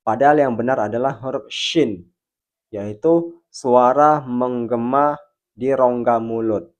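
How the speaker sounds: ordinary talk, nothing marked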